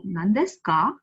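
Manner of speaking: "Nan desu ka" with ka going sharply upward; rising intonation. Shown as a demonstration. Teacher showing the question particle, rising on ka. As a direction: send the final ka up. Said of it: "ka is going upwards".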